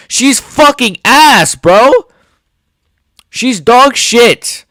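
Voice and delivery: high, loud, slow insults; angry shout. Real, not acted. Tearing into Shevchenko for viewers, slow furious shouting. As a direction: hammer each insult out in fury. Raging at the fighter.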